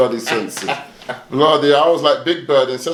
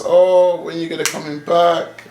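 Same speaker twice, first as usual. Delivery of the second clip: higher, slow mock parent plea; imitating pleading parents; 6 semitones higher, slower than usual. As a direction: ham up the parents begging him home.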